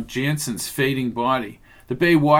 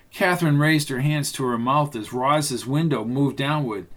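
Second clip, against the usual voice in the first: ordinary delivery, nothing marked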